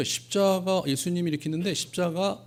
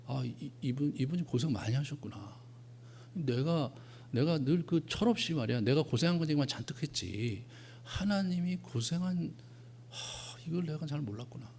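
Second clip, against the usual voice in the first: hushed inner voice; 8 dB quieter than usual. Retold or imitated. Pastor's inner realization at cross.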